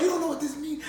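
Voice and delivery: laughing fanboy gush; overexcited fan. Retold or imitated. Overjoyed fan retold.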